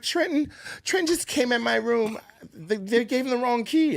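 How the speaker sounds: high flustered retelling as Sura; imitating a flustered Sura; flustered woman. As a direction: mimic her rattled voice. Voicing Sura, upset.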